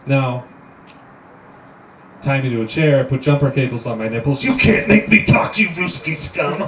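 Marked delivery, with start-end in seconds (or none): 4.4-6.6 defiant prisoner. Acted. Tied-up prisoner defying interrogators.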